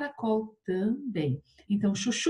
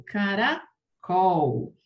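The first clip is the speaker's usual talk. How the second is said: "Caracol" broken slowly into CARA, then COL; stress on COL; 3 dB louder, much slower than usual. Shown as a demonstration. Teacher checking the accent in caracol, stressed on COL. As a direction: split "Caracol" and stretch the last syllable COL. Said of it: "Tem acento no col".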